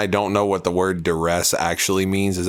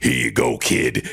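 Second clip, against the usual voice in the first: much lower, laid-back star voice; cool star; 9 semitones lower than usual. Retold or imitated. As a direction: hand it over like a cool celebrity.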